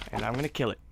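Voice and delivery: ordinary talk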